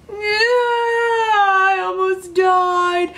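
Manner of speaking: very slow, high tearful wail; crying. Retold or imitated. Girl realizing she nearly died, crying. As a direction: break into sobs on the words. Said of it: "And I was crying".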